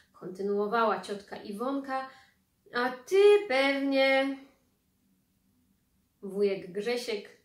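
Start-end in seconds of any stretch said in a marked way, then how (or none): 2.7-4.4 slow, much louder, higher, stressing ty; pointed reproach. Acted. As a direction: drag it out accusingly. Ciotka kończy wyrzut. (ciotka z wyrzutem, powoli akcentuje „ty”)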